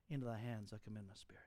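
unvoiced whisper, fast, fading out; dying whisper. Preacher voicing Jesus's last words, whispered. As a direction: breathe it out like a last breath.

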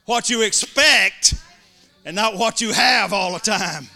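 full-force preacher's shout; preaching shout. Real, not acted. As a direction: drive it home to the congregation.

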